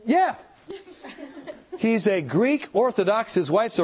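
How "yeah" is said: high-pitched, laughing mock yes; flustered agreement. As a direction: stammer into a squeaky acted yeah. Acting out his flustered agreement.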